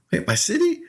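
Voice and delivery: very high, rising incredulous question; high-pitched retold shock. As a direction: squeak it out in mock disbelief. Retells panic at city being rallied.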